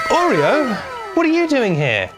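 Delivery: high-pitched astonished greeting; gasp, then greet an unexpected guest in a high voice; mock surprise. Acted surprise at dog's sudden arrival.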